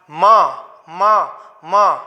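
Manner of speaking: "मां" slowly, an open syllable with audible short a; slow teaching demo of "मां", vowel audible; inherent vowel sounded. Shown as a demonstration. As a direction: repeat "मां" separately, vowel held after the m. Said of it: "आ रही है".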